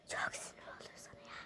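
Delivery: hushed whispered grumble; angry whisper. Acted. Vlogger whispering her anger at the parcel. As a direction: whisper the annoyance so nobody hears.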